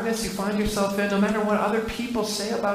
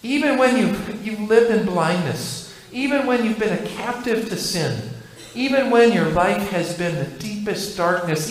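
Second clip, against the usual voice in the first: usual speaking voice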